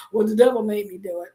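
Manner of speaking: lightly mocked blamer, low and quick; mock excuse. Preacher mimicking listeners who blame the devil, mock excuse. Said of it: "that's your lie".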